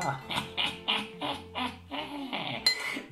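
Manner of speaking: high breathy witch cackle. Pretend. Witch laugh over mixture.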